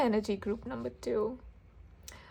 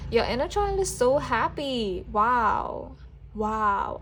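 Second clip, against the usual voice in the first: delighted, louder burst of joy; thrilled and louder; excited; 6 dB louder, slower than usual. Real, not acted. Delighted by a reading result.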